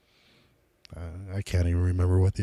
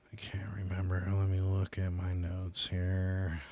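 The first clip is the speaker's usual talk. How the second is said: very soft, near-whispered aside; muttered under the breath while checking notes; talking to himself; 12 dB quieter than usual. Real, not acted. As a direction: trail into a barely voiced murmur.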